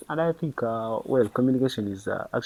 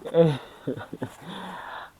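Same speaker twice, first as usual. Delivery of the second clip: laugh; 8 semitones higher, 4 dB louder than usual. Real, not acted. Laughing at the girl's reply.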